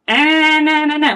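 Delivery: high, loud nasal "na, na, na" pushed forward; nasal demo. Shown as a demonstration. Voice teacher demonstrating a nasal singer's tone. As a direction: sing "na" bright and pinched in the nose. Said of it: "very nasal".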